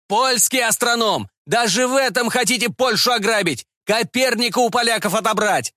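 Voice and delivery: high-pitched outraged protest; indignant cry. A narrator's reading. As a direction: cry out like a wronged patriot. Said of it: "крикнул он".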